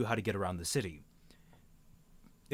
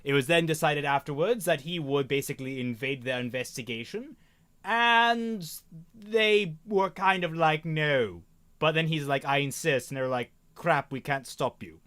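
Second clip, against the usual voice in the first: put-on posh accent. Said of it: "stop talking in this posh accent".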